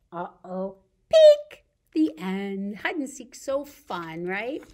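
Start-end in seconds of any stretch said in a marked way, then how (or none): 1.1-1.6 peekaboo squeal. Acted. Peekaboo game with kids.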